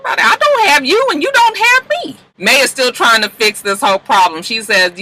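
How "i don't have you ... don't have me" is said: high, mocking diva voice; mock Mavis. Recapping a TV argument.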